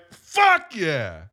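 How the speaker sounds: punchy celebratory shout; triumphant cheer. Retold or imitated. Fan acting out the theater loving that moment, triumphant. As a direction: cheer it like a thrilled audience. Audience reaction to moment, retold.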